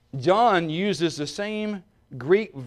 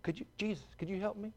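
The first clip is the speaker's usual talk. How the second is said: much softer, timid little plea; mock meek request; 4 semitones higher, 10 dB quieter than usual. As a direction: mumble it shyly, no urgency. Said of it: "He didn't say".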